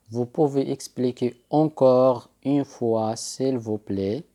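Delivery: very slow, careful French repeat; teacher repeating a request very slowly. Shown as a demonstration. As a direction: drag each word out for repetition. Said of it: "فإنك تقول".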